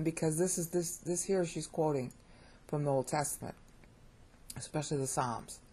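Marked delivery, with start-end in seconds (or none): none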